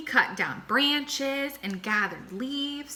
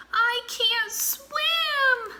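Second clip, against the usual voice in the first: very high, very slow frightened cry; panicked wail; much slower than usual. A narrator's reading. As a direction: drag it out in helpless fright. Armadillo in danger.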